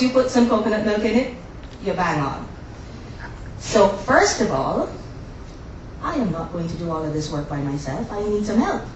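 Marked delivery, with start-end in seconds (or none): none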